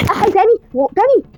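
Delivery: high, loud yelp of disbelief; shocked dismay. Real, not acted. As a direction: cry out in real shock, pitched high. Disaster strikes again in game.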